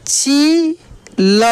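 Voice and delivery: long high ची, pause, then ल; drawn-out syllables. Shown as a demonstration. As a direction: hold ची high before sounding ल. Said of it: "खींच कर किया जाता है". (ची खींच कर, फिर ल)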